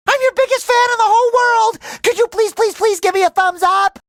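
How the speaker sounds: high, breakneck excited begging; gushing fan plea. Acted. Biggest fan begs idol for thumbs up, high excited gushing. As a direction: squeal it like a starstruck fan.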